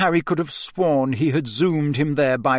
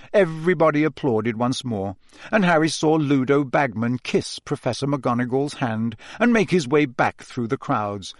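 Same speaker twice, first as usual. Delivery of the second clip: plain reading voice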